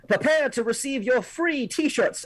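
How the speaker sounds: grand, slow announcer pitch; showman announcer. Acted. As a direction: boom it like a game-show host. Showman announcing free T-shirts.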